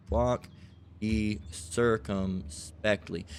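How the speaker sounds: "Walk ye circumspectly" drawn out with pauses between words; slow, spaced emphasis. Shown as a demonstration. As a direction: spell out "Walk ye circumspectly" very slowly, word by word. Repeats the phrase word by word.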